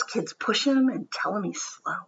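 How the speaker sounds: usual speaking voice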